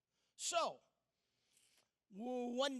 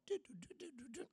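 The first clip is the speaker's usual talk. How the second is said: phone ring mimic; 5 semitones higher, 5 dB quieter, much faster than usual.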